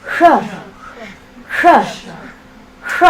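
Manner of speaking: "sh" with the tongue curled back and up; retroflex sh. Shown as a demonstration. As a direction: say "sh" darker, tongue tip pulled back to the palate. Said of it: "Back and up".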